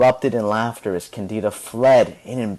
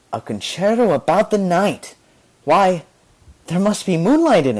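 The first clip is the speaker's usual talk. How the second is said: bright, higher voice full of wonder; excited wonder; 7 semitones higher, 3 dB louder than usual. A narrator's reading. Candida dreaming up the night concerto, delighted wonder. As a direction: marvel at the idea out loud. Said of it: "she thought".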